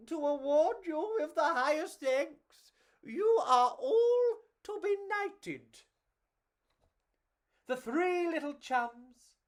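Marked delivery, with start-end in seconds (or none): 0.1-5.8 delighted queen. Acted. The queen knighting the three little bugs. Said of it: "The queen was delighted".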